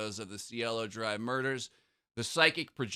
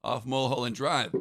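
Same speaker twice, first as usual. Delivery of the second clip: ordinary talk, nothing marked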